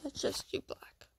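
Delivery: soft unvoiced whisper. Real, not acted. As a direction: whisper it under your breath. Whispering to herself while choosing.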